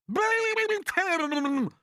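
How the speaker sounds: very high, loud, stretched-out shriek; loud cry. Acted. Aunt egging her sister on against the boy, shrieking. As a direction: shriek it, holding each word long. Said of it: "cried Aunt Sponge".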